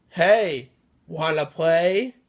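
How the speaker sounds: slow, sly, teasing dummy voice; lightly voiced menacing doll invitation; creepy dummy. Evil dummy greeting the two sisters, slow sly tease.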